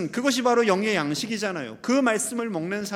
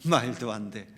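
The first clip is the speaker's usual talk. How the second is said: mock dismissive pfft, dropping low; puff, huff, then low disbelief; prickly scoffing; 9 semitones lower than usual. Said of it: "까칠한 사람들은".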